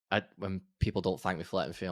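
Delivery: normal talking voice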